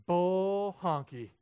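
slow, loud, high scoff; playful dismissive bull honky; mock scoff. Real, not acted.

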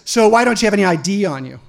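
barked question. Retold or imitated. Officer interrogates him, acted.

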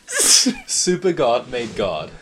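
loud, slow punchline after laughing; cracking up. Real, not acted. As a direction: announce it high through real amusement. Cracking up at own answer.

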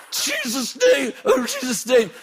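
very high, drawn-out shriek; frantic high mock scream; pained panicked cry. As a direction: squeal it as if being bitten. Cries out while being bitten.

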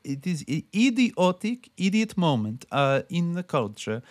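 put-on high, lightly accented broken English; imitating Jodorowsky. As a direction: mimic an old man's broken English. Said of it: "broken English".

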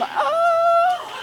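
a very high, squeaky little gasp of an oh; hammed-up flustered surprise; mock startled oh. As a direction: act caught off guard with a tiny yelp. Startled reaction to temptation.

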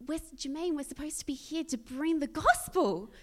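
rally a teammate with rising fervour; impassioned plea. Retold or imitated. Urging colleague Jermaine to bring the gospel, impassioned.

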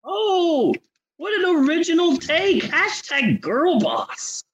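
high, gushing mock praise; sarcastic gushing. Mocking the writer-star with high sarcastic gushing. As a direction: gush with heavy sarcasm.

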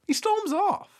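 squeaky high repeat of storms off; high mock indignant. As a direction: say it in a shrill mock-outraged squeal.

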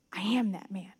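guilty whisper. Retold or imitated. Guilty king realizing his sin, in a hushed whisper.

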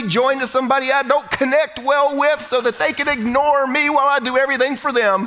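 hammed-up bride inviting everyone to misery; mock bride invitation. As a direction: sell a miserable wedding like good news. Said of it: "had somebody say".

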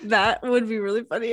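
normal talking voice

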